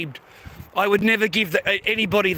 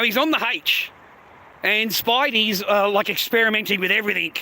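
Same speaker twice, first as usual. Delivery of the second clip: ordinary talk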